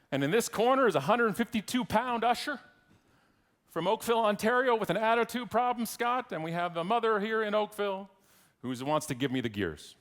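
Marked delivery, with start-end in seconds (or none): 0.1-8.1 ring announcer. Pretend. Introducing usher versus mother for fight night, mock ring announcer. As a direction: present the fighters like a boxing announcer.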